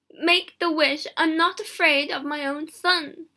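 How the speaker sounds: high, loud, defiant demand; impassioned mother's command; insistent cry. A narrator's reading. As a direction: cry out with fierce determination.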